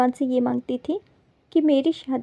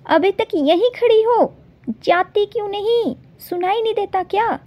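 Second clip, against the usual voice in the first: angry shout; 5 semitones higher than usual. Acted. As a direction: drive the makeup girls out of the room. Shouting at the makeup girls.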